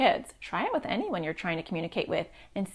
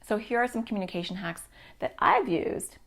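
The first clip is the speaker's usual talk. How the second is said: normal talking voice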